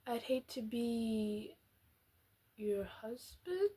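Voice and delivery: drawn-out, soft, teasing delivery; slow dramatic reading. Acted. Reading the line back slowly, mock dramatic. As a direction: stretch every word, half laughing.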